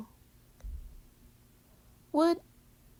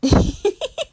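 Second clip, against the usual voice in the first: burst of laughter; 11 semitones higher, 13 dB louder than usual. Real, not acted. Bursts out laughing after threat.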